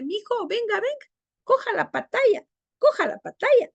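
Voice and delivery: put-on high, sing-song baby talk; baby talk to a child. Shown as a demonstration. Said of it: "la costumbre de hablar raro con el niño".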